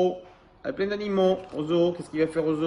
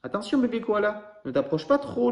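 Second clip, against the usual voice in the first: mild mock shout; 7 semitones higher than usual. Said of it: "lui crie Alistair".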